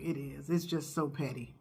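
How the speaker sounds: quiet gossiping aside; hushed. Retold or imitated. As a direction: say it low, like gossip.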